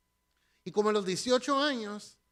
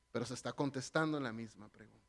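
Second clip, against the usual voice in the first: normal talking voice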